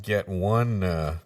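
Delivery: ordinary talk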